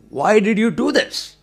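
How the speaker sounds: loud, high grilling while gripping his collar; angry demand. Acted. Car owner holds the boy's collar and demands why, angry. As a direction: grab him and demand an answer. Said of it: "held his collar and said".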